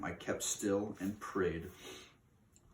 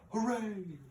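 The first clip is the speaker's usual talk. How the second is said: much higher shrill cheer; witches' cheer. Acted. Witches shout hooray together, much higher cheer. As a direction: cheer it like a gloating coven. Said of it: "all us witches shout".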